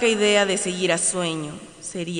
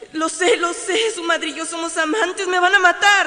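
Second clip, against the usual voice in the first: panicked; 10 semitones higher, 6 dB louder than usual. Acted. Panic building.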